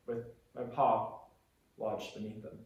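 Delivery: low, very quiet, slowing down; grieving. Acted. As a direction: let the voice sink away in grief. Recalls father's death in the crash.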